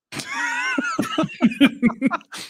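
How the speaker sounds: sudden squealing laugh; high-pitched laugh. Real, not acted. Laughs at being handed off.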